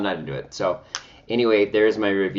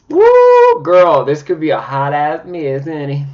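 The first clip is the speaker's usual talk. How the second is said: sassy diva; 7 semitones higher, 11 dB louder, slower than usual. Real, not acted. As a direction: camp it up like a sassy best friend. Sassy reaction to caked concealer.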